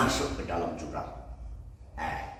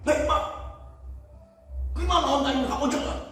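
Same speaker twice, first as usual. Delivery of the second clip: high, fast, loud mock yell at the owner; hammed-up impatient shout, rushing; frantic yell; 4 dB louder, faster than usual. Traveler yelling to the camel owner, high fast shout. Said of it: "Teriak lagi".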